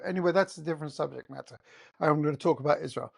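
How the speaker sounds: ordinary talk, nothing marked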